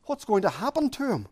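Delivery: normal talking voice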